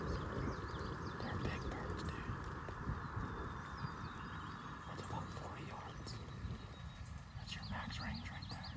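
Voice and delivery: hushed hunting whisper. Real, not acted. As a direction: whisper so the birds can't hear. Whispered guidance during a hunt.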